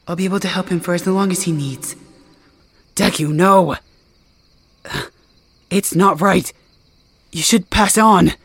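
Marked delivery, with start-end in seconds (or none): 2.9-8.3 loud, desperate plea; urgent, pleading protest. Acted. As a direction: beg him not to stay, voice raised. Begging Deku not to stay.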